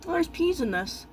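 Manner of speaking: pleased high little surprise, slowly; surprised. Real, not acted. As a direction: notice the peas with a slow lift. Finds peas in the ramen.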